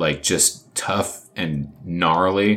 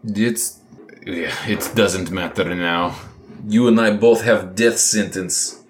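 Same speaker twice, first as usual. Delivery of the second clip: put-on lightly accented Ivan; 3 semitones higher, 3 dB louder than usual.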